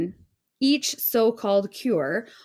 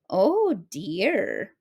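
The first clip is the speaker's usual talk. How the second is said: mildly sad. A narrator's reading. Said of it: "the old woman said sadly".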